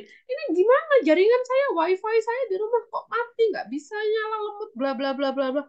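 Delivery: high-pitched nagging phone complaint; mock complaint, starting high and trailing into blah-blah; complaining customer. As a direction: play an upset customer griping on and on. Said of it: "Banyak customer yang komplain".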